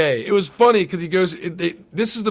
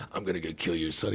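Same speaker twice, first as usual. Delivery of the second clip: menacing killer threat; 12 semitones lower, 13 dB quieter than usual. Retold or imitated.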